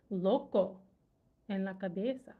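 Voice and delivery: usual speaking voice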